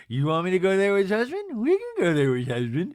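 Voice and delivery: light mocking mimicry, swooping pitch; Meredith impression. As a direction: mimic her in an affected, slurred voice. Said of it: "[name] does a little light Meredith".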